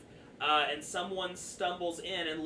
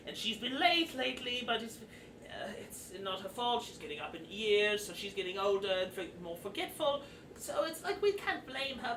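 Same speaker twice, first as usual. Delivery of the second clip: higher-pitched, fussy goblin grumble; complaining goblin; 4 semitones higher than usual. Acted. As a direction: gripe like a harried goblin clerk. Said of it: "Nicholas looks up at you and goes".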